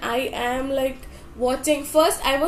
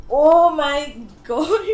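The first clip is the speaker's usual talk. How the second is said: louder, slow, laughing through it; laughing exclamation; 8 dB louder, slower than usual. Real, not acted. Fan overwhelmed by the video, laughing exclamation. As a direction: break into a giddy laugh mid-word.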